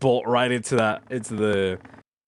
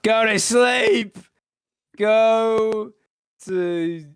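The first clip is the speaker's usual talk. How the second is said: high, drawn-out holler of "go to sleep"; drowsy character's shout; 10 semitones higher, much slower than usual. Acted. Sleeper shouting at whoever knocks on the door, high and drawn out. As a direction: shout it high, stretching each word long. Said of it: "and just shout".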